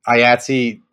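normal talking voice

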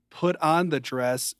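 normal talking voice

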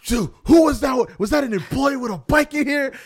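put-on high, loud, outraged old-man shout; spazzing old man. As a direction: shriek like a scandalized customer. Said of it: "pushes the tape".

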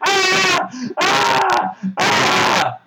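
high shrieking mock screams; hammed-up horror shrieks.

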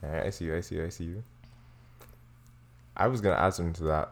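ordinary talk, nothing marked

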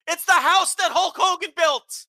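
high, loud indignant rant; impassioned shouting. Real, not acted. Wrestling podcaster ranting about the embarrassed company, impassioned shouting. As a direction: holler it with real outrage.

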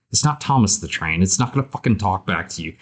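ordinary delivery

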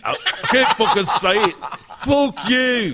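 high, shouted mock Scottish rant; drunk Scottish accent. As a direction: bellow like a sozzled Irish-Scottish guy. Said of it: "say that in your Scottish accent".

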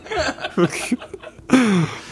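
a high, cackling laugh; a hammed-up cackle. Laugh after crude line.

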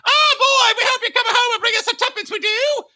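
very high, shouty Cockney mother, like a Muppet; hammed-up Cockney wail from a Muppet Christmas Carol; ridiculous Cockney accent. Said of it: "the most ridiculous Cockney accent".

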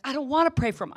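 flat-out refuse, almost shouting; stubborn petulant protest to the sponsor; defiant refusal. Retold or imitated. Acted quarrel with the sponsor.

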